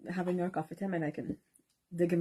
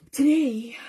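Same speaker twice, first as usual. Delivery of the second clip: loud emphatic; 8 semitones higher, 12 dB louder than usual. Real, not acted.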